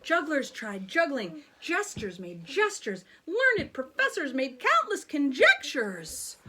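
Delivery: ordinary delivery, nothing marked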